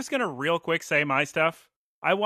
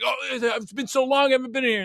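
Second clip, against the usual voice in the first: imitating old man; 10 semitones higher, 3 dB louder than usual. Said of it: "you know, like".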